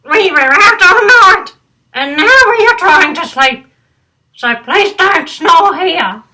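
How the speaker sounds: high, loud squeaky bat. Acted. Sleepy bat telling Bernard they couldn't sleep, high and loud. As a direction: squeak it back, annoyed. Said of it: "replied a bat".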